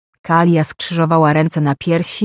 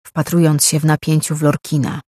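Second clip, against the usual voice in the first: ordinary delivery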